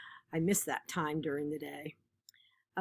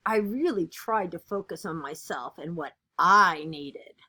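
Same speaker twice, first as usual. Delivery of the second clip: lively, expressive picture-book reading; animated read-aloud of a kids' book; reading to children; 2 semitones higher, 5 dB louder than usual. Acted. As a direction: read it like storytime for a class.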